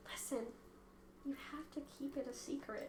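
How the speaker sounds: very soft, secretive whisper. Acted. Seer kneels to the cat to share a secret, whispering. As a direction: whisper it like a confidence. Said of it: "I'm gonna whisper".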